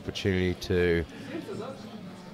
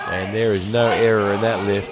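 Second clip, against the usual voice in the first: louder, thrilled call of the lift; excited; 7 dB louder than usual. Real, not acted. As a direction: celebrate the clean lift out loud. A clean lift, cheering it.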